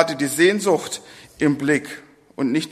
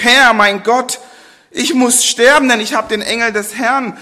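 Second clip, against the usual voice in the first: higher, louder cry of fear; frightened cry; 6 semitones higher, 6 dB louder than usual. Acted. Gideon, terrified after seeing the angel, cries out. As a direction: cry out that you must die. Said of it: "Er rief".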